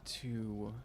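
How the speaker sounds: usual speaking voice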